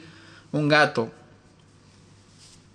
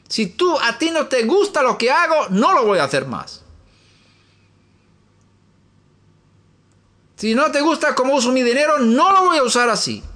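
raised, pleading prayer voice, pitched up; earnest prayer; 10 semitones higher than usual. Acted. Preacher models a surrendering prayer, pleading and high. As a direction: pour yourself out to God. Prays aloud, surrendering to God.

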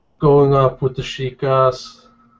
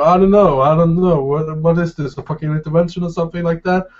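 higher and louder, rattling it off fast; excited ribbing; 5 semitones higher, 4 dB louder than usual. Acted. [name] teases Joshua about an intervention, loud and fast. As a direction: tease him like a rowdy pal.

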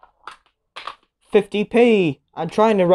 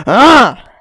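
frustrated cry; 6 semitones higher, 10 dB louder than usual. Real, not acted. Red box revealed again.

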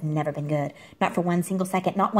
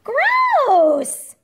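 much higher and louder, shrieked disgust; disgusted squeal; 12 semitones higher, 6 dB louder, much slower than usual. Real, not acted. Reacting to the dog's poo, squealing disgust. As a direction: squeal out in playful revulsion.

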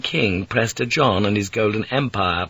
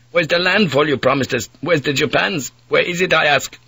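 angry Dutch mate; 6 semitones higher than usual. Acted. Mate demanding the promised Japans, higher angry Dutch voice. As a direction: press the pilot hard.

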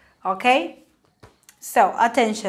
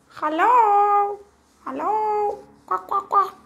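high duck voice calling hello; little duck calling from behind the book; 10 semitones higher, slower than usual. Pretend. Hidden duck puppet calling to the class, high voice.

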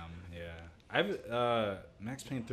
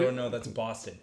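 ordinary delivery

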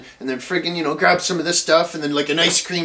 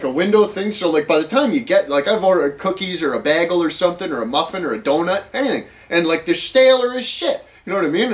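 normal talking voice

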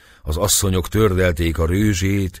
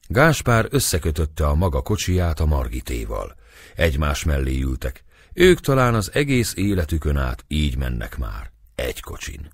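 usual speaking voice

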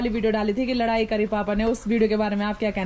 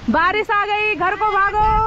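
calling out; 9 semitones higher, 4 dB louder, much slower than usual. Real, not acted. Rain arrived, calling to run.

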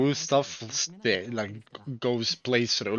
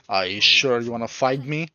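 ordinary talk

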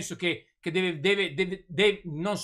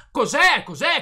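angry shout; 6 semitones higher, 5 dB louder, slower than usual. Real, not acted. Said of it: "Non gridare".

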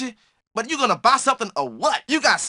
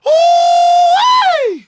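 very high, loud holler; excited whoop; 8 dB louder than usual. Acted. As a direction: whoop it up as the fight starts. Fight starts, whoop.

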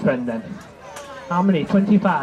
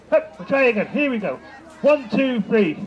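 ordinary talk

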